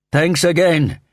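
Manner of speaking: high, loud shouted thanks; projected yell across a noisy plane. A narrator's reading. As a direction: holler it to the pilot over engine noise. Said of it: "he shouted to the pilot".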